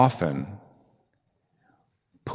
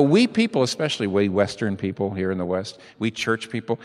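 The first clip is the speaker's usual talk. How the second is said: ordinary delivery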